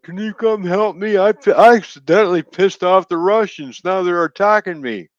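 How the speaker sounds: mock him as a pathetic crybaby begging for help; mocking whiny plea. Mocking the Ukrainian leader begging the world, high whiny voice. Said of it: "now he's crying out to the rest of the world".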